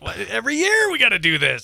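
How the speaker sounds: high, fed-up groan of annoyance; every spring, the deer again; exasperated. Retold or imitated. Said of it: "I'm like".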